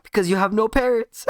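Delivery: very high, loud jeer; gleeful taunt. Acted. Naruto jeering at orphaned Sasuke, gleefully loud. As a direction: crow it out, about to burst out laughing.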